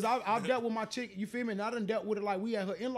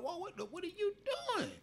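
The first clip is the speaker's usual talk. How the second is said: high, startled catching-out cry; mock shocked confrontation; shocked whoa; 12 semitones higher, 5 dB quieter than usual. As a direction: act like you just caught her watching porn. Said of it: "I'm like".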